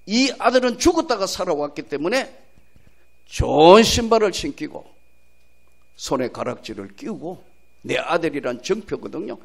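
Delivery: normal talking voice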